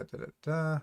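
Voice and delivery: usual speaking voice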